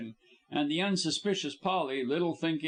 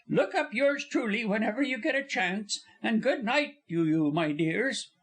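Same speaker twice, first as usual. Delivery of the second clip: impatient good humor; 11 semitones higher than usual. Acted. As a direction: shoo them with a laugh in it. Polly dismissing the men.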